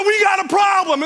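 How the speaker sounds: high, loud mock emergency announcement; retold alarmed shout. Customer bursting into the gas station, retold alarmed shout. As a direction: burst in shouting, comically panicked.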